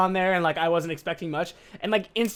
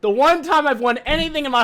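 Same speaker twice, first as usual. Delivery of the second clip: frustrated shout; 9 semitones higher, 7 dB louder than usual. Real, not acted. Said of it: "real frustration".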